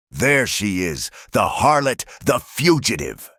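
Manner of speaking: the reverend screams at Eliza before the mob; screaming preacher. A narrator's reading. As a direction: point and scream at her. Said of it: "he screamed".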